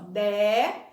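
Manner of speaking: "Dé" drawn out with a wide open É. Shown as a demonstration. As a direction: hold the open vowel of "Dé". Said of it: "é aberto".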